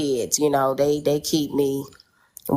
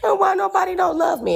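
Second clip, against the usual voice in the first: mock crying wail. Mother retelling her own crying to her children, high sobbing wail. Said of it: "I'd be crying".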